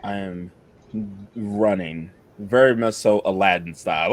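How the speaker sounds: ordinary talk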